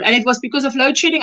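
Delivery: normal talking voice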